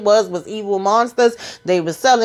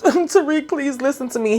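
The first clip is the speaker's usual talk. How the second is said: higher, a touch of hammed-up crying; mock tearful plea; 6 semitones higher than usual. Woman pleading with Tariq as he leaves, mock tears. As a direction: beg him through fake tears. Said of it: "she got all her tears".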